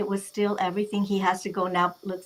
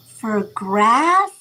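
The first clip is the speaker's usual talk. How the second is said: loud disbelief climbing on grass; retold indignant astonishment, voice jumping up; incredulous; 8 dB louder than usual. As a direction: throw it back as an outraged question. Retold outrage at the grave's price.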